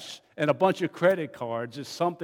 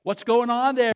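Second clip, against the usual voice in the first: higher, louder worried question; alarmed; 7 semitones higher, 5 dB louder than usual. A narrator's reading. Boss on the phone, alarmed by the noise. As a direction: demand it in alarm. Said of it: "now alarmed".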